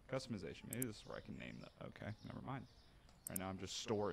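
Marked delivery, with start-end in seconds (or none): none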